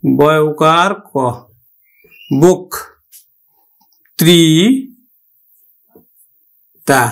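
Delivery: বক ত্রি তা split slowly into syllables, বো sound on the first. Shown as a demonstration. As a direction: spell out বক্তৃতা as বোক-ত্রি-তা with long pauses. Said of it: "আমরা উচ্চারণ করব কিন্তু".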